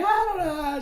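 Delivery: put-on high, panicked little girl; frantic child. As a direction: squeal it out like a scared kid. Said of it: "She come out frantic".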